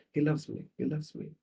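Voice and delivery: soft, repeated self-convincing; quietly acted hurt woman; understated weeping act. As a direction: convince yourself in a small voice. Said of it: "and she's weeping".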